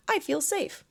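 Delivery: high, forced, unbelievable "I feel safe"; unconvincing affirmation. Shown as a demonstration. Coach mocks an affirmation the body rejects, pitched high and forced. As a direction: say it like you don't buy it. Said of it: "No, you don't".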